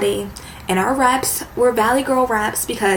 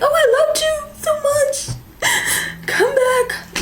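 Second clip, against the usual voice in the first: high, wailing fake sob; mock crying. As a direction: beg like a hammed-up heartbroken ex. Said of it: "because you're like".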